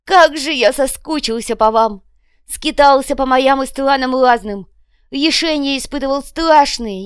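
high, loud, stretched-out oratory; addressing the crowd. A narrator's reading. Копыта addresses his kinsfolk after exile, gushing loud oratory. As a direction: declaim grandly to your people from a makeshift stage. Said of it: "обратился к сородичам".

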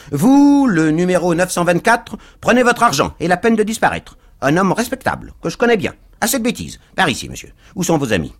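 high-pitched string of chopped phrases; choppy, voluble stranger. A narrator's reading. Stranger hustles Pickwick away from the crowd, voluble and choppy. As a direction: rattle out commands in short high bursts. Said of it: "chapelet de ces phrases hachées".

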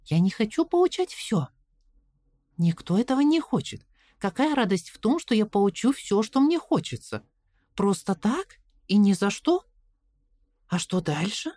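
put-on anxious little girl asking; girl's voice. A narrator's reading. Caroline argues. (голос встревоженной девочки)

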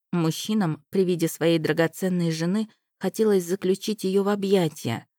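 usual speaking voice

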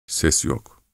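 usual speaking voice

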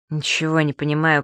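ordinary delivery

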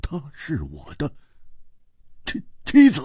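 high, startled stammer. Acted. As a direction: falter in shocked disbelief. 吓一跳，难以置信. (震惊得结结巴巴)

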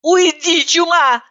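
high, angry cry of go away; angry outburst. A narrator's reading. Narrator angrily drives roommate away, high cry. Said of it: "разозлилась я".

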